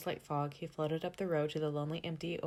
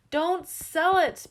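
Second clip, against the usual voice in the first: very high, loud and slow plea; begging; 11 semitones higher, 12 dB louder, much slower than usual. A narrator's reading. Gus begs Mister Scott not to sell, high drawn-out plea. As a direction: stretch it out, pleading. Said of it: "begged Gus".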